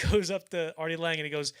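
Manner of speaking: ordinary talk, nothing marked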